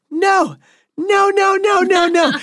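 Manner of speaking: mock a frantic wife refusing; imitating panicked refusal. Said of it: "and she goes".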